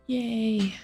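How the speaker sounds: normal talking voice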